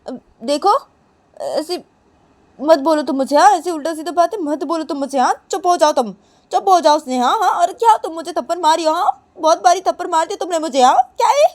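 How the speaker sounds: high, loud hurt scolding; whiny angry rebuke; indignant scolding. Acted. Neha scolds Sneha after slaps.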